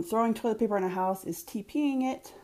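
ordinary talk, nothing marked

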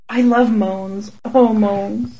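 slow, low, sultry purr; breathy seductive moaning. Pretend. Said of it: "then I'll just go with".

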